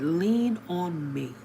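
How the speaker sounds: soft, very slow retold offer of support; gentle reassurance. Old man to crying stranger, gently reassuring. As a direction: draw it out softly and steadily.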